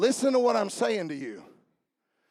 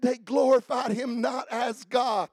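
shouted scripture; 3 semitones higher, 4 dB louder than usual. Acted. Pastor shouting the verse back to the church. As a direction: proclaim the verse at full voice.

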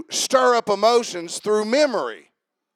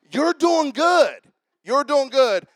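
encouraging; 8 semitones higher, 7 dB louder, slower than usual. Acted. Drawn out encouragement to volunteer.

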